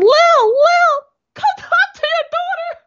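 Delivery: high, loud frantic calling out; panicked call for Lil. Retold or imitated. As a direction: yell across the house in dismay. Father yelling for mother.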